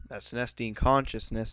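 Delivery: ordinary talk, nothing marked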